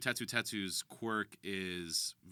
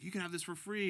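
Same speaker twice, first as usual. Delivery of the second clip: higher-pitched vendor's offer; food stand guy; 8 semitones higher than usual. Acted. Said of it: "food stand guy who interacts with Mountain Lady".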